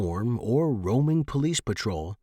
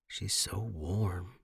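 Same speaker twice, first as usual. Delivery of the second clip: soft and slow; 3 semitones lower, 8 dB quieter, much slower than usual. A narrator's reading. Tender intimate moment.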